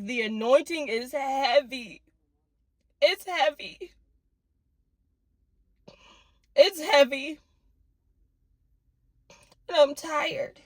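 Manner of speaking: real tears, long pauses between words; worn out and weeping; crying. Real, not acted. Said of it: "sad tears".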